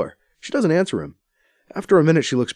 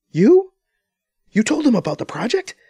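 shocked high loud You; stunned disbelief; surprised and angry; 4 dB louder than usual. A narrator's reading. Anita learning B C is CEO, shocked. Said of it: "Anita says in surprise and anger".

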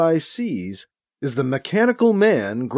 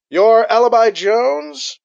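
slow, loud, higher cyborg voice; artificially loud voice; 8 semitones higher, 4 dB louder than usual. A narrator's reading. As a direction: speak like a miked-up cyborg. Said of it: "in his artificially loud voice".

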